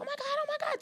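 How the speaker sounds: very high, breathy mock fangirl squeal; mimicking the girl next to her squealing; mocking a starstruck fan. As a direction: imitate the pestering neighbour gushing. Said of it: "She kept on going".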